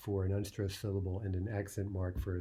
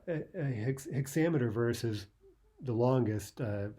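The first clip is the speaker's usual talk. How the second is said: normal talking voice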